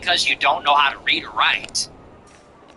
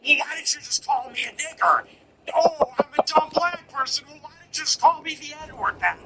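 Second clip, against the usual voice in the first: mock-wounded voice, pitched much higher; playing the victim; 9 semitones higher than usual. Comedian mimicking her race-card complaint, high mock-wounded voice. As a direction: imitate her complaint in a high, hammed-up whine. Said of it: "then she plays the race card".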